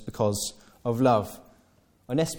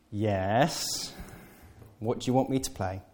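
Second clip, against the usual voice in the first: knowing reply; 5 dB quieter than usual. Retold or imitated. Kate answering the flatterer, knowingly resigned. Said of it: "And Kate goes".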